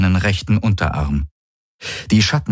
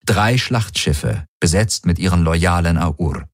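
usual speaking voice

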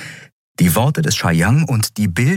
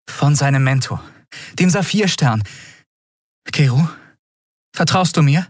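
high, careful character voice; Endriel, earnest; 8 semitones higher than usual. Acted. Endriel asks Keru to trust her, earnest. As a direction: appeal for trust, pitched up.

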